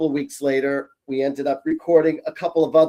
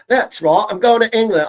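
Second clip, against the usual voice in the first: high, loud British accent; 5 semitones higher, 7 dB louder than usual. Shown as a demonstration. Psychic telling viewers about a trip to England, in British accent. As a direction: put on a playful British accent, pitched up. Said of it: "brush up on my British".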